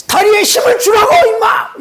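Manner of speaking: high, loud coaching shout; angry shout. Retold or imitated. Pastor shouting at his son on the ski slope. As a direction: yell at him to push harder. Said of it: "이러면서 제가 했거든요".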